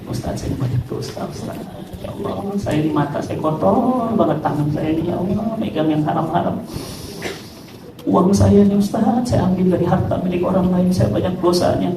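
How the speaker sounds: hushed, guilty confession to the ustaz; soft and ashamed, almost whispered; mild repentant whisper. Retold or imitated. Sinner confides in the ustaz, quiet and ashamed.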